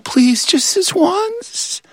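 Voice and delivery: high, slow pleading prayer. Retold or imitated. Kid hoping for the last sandwich, slow high plea. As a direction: beg silently, slow and high.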